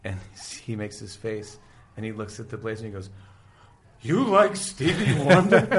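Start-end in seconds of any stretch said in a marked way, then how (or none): 4.0-5.8 loud, high, drawn-out question; incredulous mock outburst. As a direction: cry it out slowly in amused disbelief. Character's punchline to the blazer.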